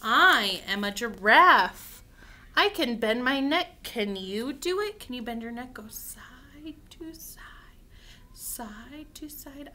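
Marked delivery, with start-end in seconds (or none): 0.0-5.0 bold picture-book read-aloud; reading to children. Acted. 5.7-9.7 hushed, drawn-out whisper; slow whisper. Shown as a demonstration. Demonstrates slow neck bending to children.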